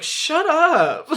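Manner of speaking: high, loud flustered "shut up"; embarrassed outburst. Retold or imitated. Knuckles snapping back at Sonic in the Japanese version, embarrassed and high. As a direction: blurt it out, caught off guard and embarrassed. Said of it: "acts embarrassed".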